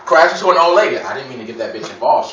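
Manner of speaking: ordinary talk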